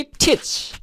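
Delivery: "Teach" with a breathy, aspirated t; model pronunciation. Shown as a demonstration. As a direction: say "Teach" as "theech", big puff on the t. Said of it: "উচ্চারণ খেয়াল করুন".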